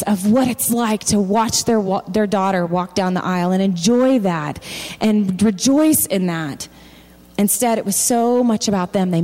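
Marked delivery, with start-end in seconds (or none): none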